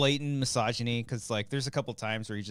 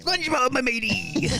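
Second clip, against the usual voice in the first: high, loud pirate-crab voice; Mister Krabs voice; 13 semitones higher, 4 dB louder than usual. Retold or imitated. Friend answers with his own crab impression, pirate greeting. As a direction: hail him like an old sailor. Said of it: "We should do SpongeBob impressions".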